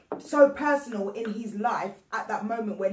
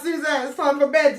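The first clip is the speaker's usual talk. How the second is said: raised-voice echo of a bedtime call; mock calling out; 4 semitones higher, 8 dB louder than usual. Everyone echoing mum's call for bed, mock calling out. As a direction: call it out teasingly, half laughing.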